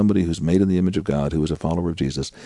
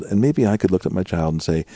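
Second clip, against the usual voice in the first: ordinary talk, nothing marked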